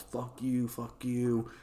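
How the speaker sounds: mild mock yell; mock hurled insults. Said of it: "They're being hurled insults".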